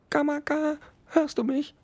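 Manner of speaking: tiny high-pitched beetle calling out; small insect voice, called up the ship. Acted. Beetle calls through the grate.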